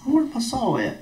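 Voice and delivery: high, baffled where's-my-saw cry; sudden disbelief at the missing saw; finding the saw gone, a high puzzled cry; exasperated what. Retold or imitated. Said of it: "I'm like".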